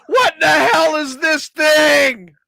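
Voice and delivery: high, drawn-out mock yell; loud, high-pitched imitation of a coworker yelling; Janet. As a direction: yell it out, high and slow, in outraged disbelief. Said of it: "we hear Janet yell out".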